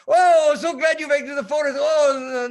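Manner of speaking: high, loud, gushing welcome; sweet operator. Retold or imitated. Chilean operator welcoming the sailor, high and gushing. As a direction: gush with delight. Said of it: "She's just so sweet".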